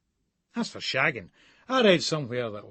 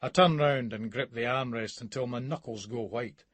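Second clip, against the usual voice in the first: ordinary delivery, nothing marked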